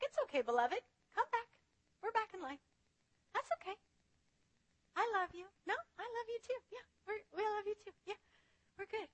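soft, hushed and high reassurance; 1 tender God voice. Retold or imitated. God welcoming his child back, soft and tender. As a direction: gently coax a child back home. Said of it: "He's like".

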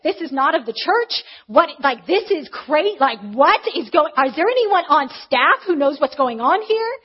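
loud, indignant mimicry with heavy emphasis; dramatic attack. Newcomer accusing the group before everyone, dramatic attack. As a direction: act her out attacking the group, loudly. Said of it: "completely attacked".

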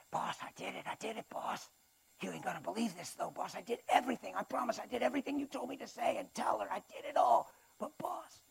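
hushed, cowering little henchman; anxious minion making excuses; pleading imp. Acted. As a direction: plead softly with the boss, voice climbing. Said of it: "he goes back to the boss".

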